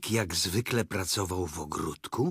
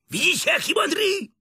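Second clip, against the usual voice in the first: very high, loud tiger voice; excited Tigger; 7 dB louder than usual. A narrator's reading. Tigger showing off clever Pooh, loud high crowing.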